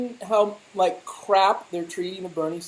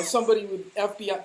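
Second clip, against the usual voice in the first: ordinary delivery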